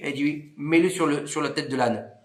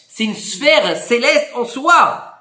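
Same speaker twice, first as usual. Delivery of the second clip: emphase solennelle; 4 semitones higher, 5 dB louder, much slower than usual. Real, not acted. Insiste sur la grandeur de la lettre.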